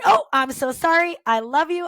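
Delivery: high, loud burst of retold apology; exclaimed apology. Teacher answers waiting students on Friday, exclaimed apology. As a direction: exclaim it as if just reminded.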